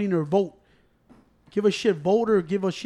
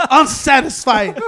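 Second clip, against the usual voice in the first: laughing; 6 semitones higher, 9 dB louder, slower than usual. Retold or imitated. Retold answer to pollster.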